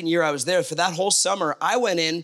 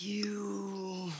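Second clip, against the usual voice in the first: breathy unvoiced whisper under the breath; a fed-up whisper of a single word; whispered curse; 3 semitones higher, 14 dB quieter than usual. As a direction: mutter it so the boss can't hear. Frustrated at boss, muttered to self.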